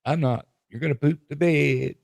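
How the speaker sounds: high mock witchy curse; laying a curse. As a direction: hex her in a put-on high voice.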